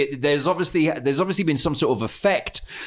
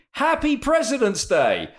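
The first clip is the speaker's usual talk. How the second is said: higher, cheery mock greeting; lightly voiced Bill, chirpy; mock Bill Clinton; 10 semitones higher than usual. Bill cheerfully greets Hillary, higher chirpy voice. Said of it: "Bill is saying to Hillary".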